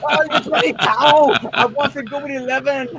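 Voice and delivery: high, loud Scottish rant breaking into laughter; mock yelling. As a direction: yell it like an exasperated Scot in a comedy sketch. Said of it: "they're just yelling at each".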